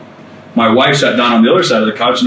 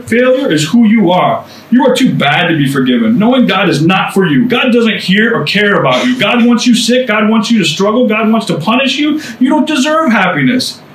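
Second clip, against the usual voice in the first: higher, taunting inner critic; accusing old voice; 7 semitones higher than usual. Retold or imitated. Preacher voicing the lying inner voice, higher and taunting. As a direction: sneer it like the voice in your head. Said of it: "that old voice that says".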